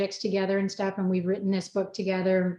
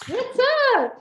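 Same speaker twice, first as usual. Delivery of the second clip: mock greeting; 5 dB louder than usual. Playful mock greeting in chat.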